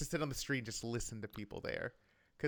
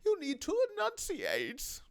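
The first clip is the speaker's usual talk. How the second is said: much higher, slow, put-on upper-class voice; posh aristocrat; slower than usual. Host mocking British aristocrats, high slow posh voice. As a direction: look down your nose and enunciate slowly.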